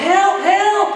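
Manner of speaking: high, loud acted cry for help; yelling for help. Agent flees a bull toward the fence, yelling for help. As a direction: yell in mock panic, pitch way up. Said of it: "the guy's yelling".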